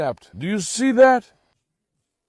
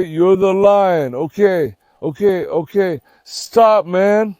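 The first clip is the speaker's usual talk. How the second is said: normal talking voice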